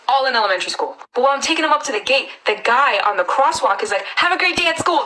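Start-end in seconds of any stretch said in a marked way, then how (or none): 4.2-5.0 higher-pitched mimicked crossing guard; retold cheery send-off. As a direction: imitate a guard talking to a little kid. Crosswalk guard calling to child.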